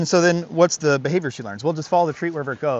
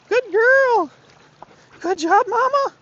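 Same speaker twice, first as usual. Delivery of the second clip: much higher, louder dog praise; high-pitched praise; 5 dB louder, much slower than usual. Real, not acted. Trainer praises his dog crossing, squeaky high praise.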